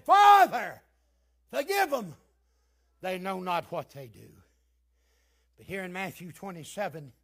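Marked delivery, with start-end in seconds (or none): 0.0-4.3 crying out as Jesus. Acted. Preacher repeating Jesus' plea: loud cry, then falling off. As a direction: cry forgive them, then let it drop. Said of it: "He said".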